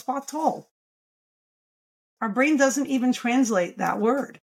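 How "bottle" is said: "bottle" as a puzzling bah-TOLL; weight the second syllable of "bottle"; misplaced stress. Shown as a demonstration. Therapist voicing a baffled listener, second syllable stressed in "bottle". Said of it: "We're not expecting someone to say bottle".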